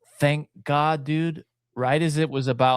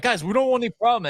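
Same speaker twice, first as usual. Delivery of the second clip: nervous pleading; 10 semitones higher, faster than usual. Retold or imitated. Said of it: "and then we're like".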